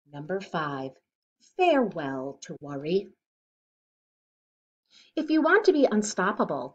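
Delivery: steady, bright storybook read-aloud; reading to children. Acted. Reader sharing a picture book with kids, rhythmic read-aloud. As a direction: read each rhyme to a young listener.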